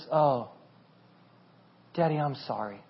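very soft, low, hesitant apology; imitated sorry child. Daughter realizes and apologizes to Daddy, quietly contrite. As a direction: apologize softly after a long pause. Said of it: "And she goes".

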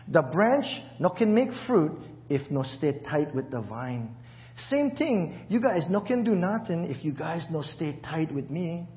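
scripture read in Pidgin. Acted. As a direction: read the verses in local Pidgin. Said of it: "want to hear it in Pidgin".

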